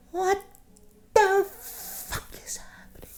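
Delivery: high, thin whisper, drawn out slowly; hushed alarm. Retold or imitated. Teller in bed as roommate yells, hushed alarm. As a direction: whisper in alarm from under the covers. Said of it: "Under the covers".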